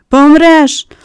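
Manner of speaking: loud, high angry outburst; girl angrily shouting down the sick boy. A narrator's reading. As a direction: shout it at him in anger. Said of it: "сердито вигукнула Мері".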